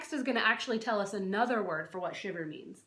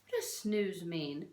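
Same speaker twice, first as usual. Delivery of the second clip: ordinary delivery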